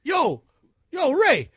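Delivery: loud, high-pitched incredulous holler; neighbors calling out. Retold or imitated. Neighbors on the balcony ask Ray about yelling, hollering. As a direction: call across the courtyard, laughter creeping in. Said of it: "people sitting down saying".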